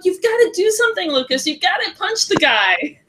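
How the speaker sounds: high-pitched mock yell at a book; retold yelling. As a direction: re-enact yelling urgent orders at a character. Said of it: "I was screaming at, yelling at the book".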